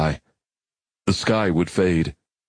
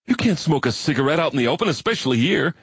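fast, much higher, alarmed; shocked outburst at a smoker; horrified; 8 semitones higher, faster than usual. A narrator's reading.

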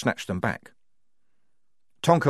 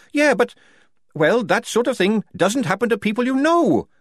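Tonka protesting; 13 semitones higher, 5 dB louder than usual. A narrator's reading. Tonka protesting, high pitched.

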